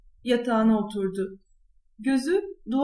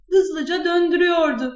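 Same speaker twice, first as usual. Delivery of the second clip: plain reading voice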